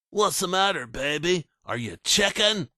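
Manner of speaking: high, sneering schoolyard taunt; mocking taunt. Coker taunting the younger boy, mocking. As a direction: pounce on him with mockery. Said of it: "Coker pounced".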